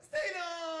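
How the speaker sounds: high, loud flamboyant hello; sing-song camp hello; effeminate greeting. Retold or imitated. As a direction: greet like a camp old friend. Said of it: "şöyle bir karakter var".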